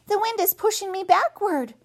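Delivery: put-on a small child asking; young gosling voice. Gosling struggles.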